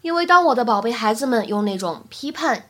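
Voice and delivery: usual speaking voice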